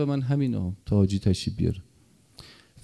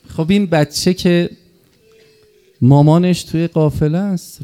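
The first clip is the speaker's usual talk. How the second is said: louder chanted lament over Karbala; mourning recitation; 2 semitones higher, 4 dB louder than usual. Acted. Preacher recounts the infant's death to weeping mourners, in a loud lament. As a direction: preach the martyrdom to move them to tears.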